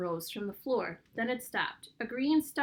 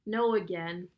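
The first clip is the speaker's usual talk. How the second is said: ordinary delivery, nothing marked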